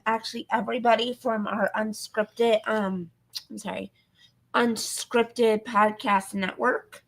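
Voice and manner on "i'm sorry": hoarse, tired voice; losing voice. Real, not acted. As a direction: speak through a fading voice. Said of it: "Sorry, I'm losing my voice".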